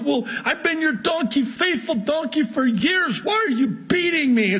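high, hammed-up donkey complaining; the wounded faithful donkey whining at its master; hurt donkey voice. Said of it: "he's going back and forth".

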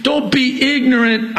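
"Don't be ignorant" shouted high, DON'T hammered; yelling. Real, not acted. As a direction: cry "Don't be ignorant" out as a high pulpit yell. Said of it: "I'm sorry, I'm yelling".

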